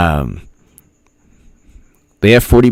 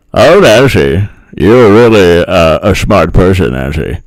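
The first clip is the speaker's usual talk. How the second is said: Vicissitude's voice. Acted. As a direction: taunt Nancy with bravado. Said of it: "do my usual voice for Vicissitude".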